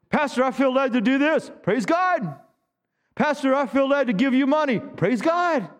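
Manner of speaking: high-pitched, enthusiastic imitation of volunteers; mock eager exchange. Members offering help to pastor and his praise, acted excitement. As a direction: pitch it up with rising zeal.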